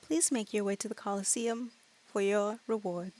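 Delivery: soft, measured dragon voice. Acted. Dragon directs the party to the Colosseum, soft voice. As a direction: speak quietly as a courteous creature.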